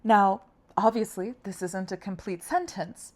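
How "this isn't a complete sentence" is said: normal talking voice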